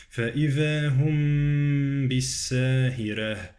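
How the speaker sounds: slow recitation, long hummed m in "هم"; ikhfa of mim. Shown as a demonstration. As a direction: hold "هم" nasally before "بالساهرة". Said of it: "إخفاء الميم".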